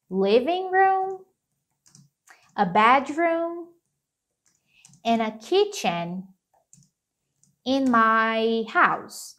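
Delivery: each item drawn out with long pauses; slow English demo list; slow teacher model. Shown as a demonstration. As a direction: lay the list out slowly for learners. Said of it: "eu posso falar".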